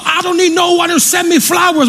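put-on high, forceful South African-accented preaching; South African preacher. Imitating a pastor refusing praise, strong South African accent. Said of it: "he's preaching it strong".

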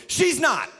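ordinary talk

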